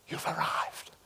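hushed, breathed whisper; whispered welcome. Retold or imitated. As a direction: whisper it like a tender greeting. Imagined welcome at the river.

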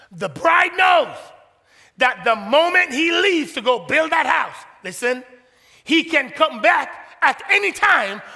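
loud, rising preacher's cry; preaching at full force. Real, not acted.

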